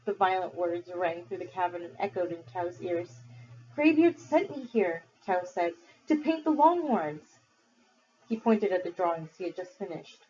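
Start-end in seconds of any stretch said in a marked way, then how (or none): 3.7-5.0 put-on high, slow earnest boy; pleading boy. Accused boy defends himself. 6.1-7.4 put-on high, slow earnest boy; pleading boy. Accused boy defends himself.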